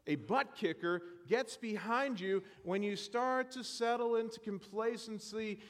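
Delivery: normal talking voice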